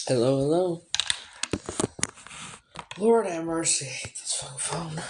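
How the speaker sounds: ordinary delivery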